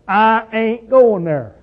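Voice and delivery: slow, drawn-out hillbilly drawl; hillbilly accent, dragged out slow; hillbilly twang. Shown as a demonstration. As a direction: let the country hillbilly in you talk. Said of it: "when I have the hillbilly running in me".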